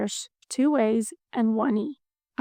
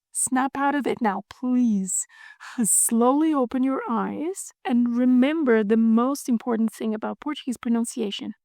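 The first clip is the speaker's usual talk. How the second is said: normal talking voice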